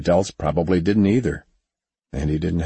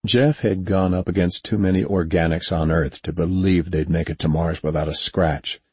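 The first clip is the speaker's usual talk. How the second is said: usual speaking voice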